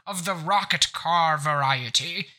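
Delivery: a rich snob in a monocle demanding a t-shirt; snooty aristocrat. Pretend. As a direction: sniff at the peasants like a top-hatted snob. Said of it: "tuxedo ... top hat and a cane".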